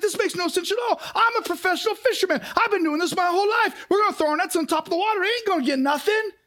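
a hint of a cocky fisherman scoffing; indignant Peter. Peter scoffing at Jesus's order, lightly voiced. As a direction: scoff like a pro who knows better. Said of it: "Peter's like".

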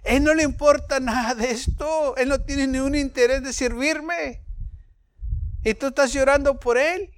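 a hint of God scolding Samuel; the Lord speaking. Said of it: "Y el Señor dijo".

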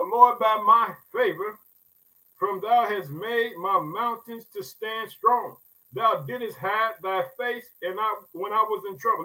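slow, lifted reading of the psalm; preacher intoning scripture; solemn scripture reading. Acted. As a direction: read the verse slowly like a prayer.

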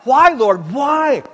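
loud, high cry of why; emphatic whys. Retold or imitated. Habakkuk crying to God, loud emphatic why. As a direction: shout both whys up at heaven. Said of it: "two emphatic whys".

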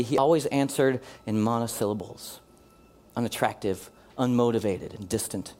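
low, soft story reading; hushed, measured storyteller; story narration. A narrator's reading. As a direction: read a sad tale quietly, with pauses. Reads a story to the congregation.

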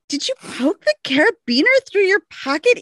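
high, exasperated scolding; the friend diagnosing the snag; friend's exasperated reply. Retold or imitated. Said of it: "And she's like".

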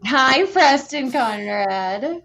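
high, drawn-out Southern hello; big Southern drawl, pitched high; Southern accent. Real, not acted. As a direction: sing out a slow, loud Southern greeting. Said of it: "I love hearing your Southern voice".

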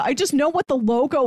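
ordinary talk

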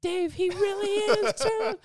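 high, urgent mock woman's voice; imitating the wife. Youth pastor's wife urging husband Dave in a high voice. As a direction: squeak it out as the alarmed wife.

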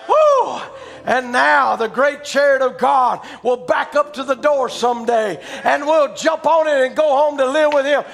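loud, high, slow preacher's cry; rising revival preaching; preaching at full force. Acted. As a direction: proclaim it to the congregation.

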